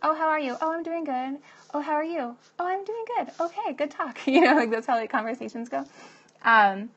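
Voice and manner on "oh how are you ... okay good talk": high-pitched, sing-song pleasantries; mock small talk. Acting out how people have conversations, in high-pitched fake politeness. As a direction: parody an empty polite exchange. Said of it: "have conversations and it's like".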